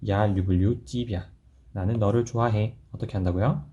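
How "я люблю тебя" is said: slow, clear Я люблю тебя; slow repeat. Shown as a demonstration. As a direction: say it slowly so students copy. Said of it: "다시 해볼게요".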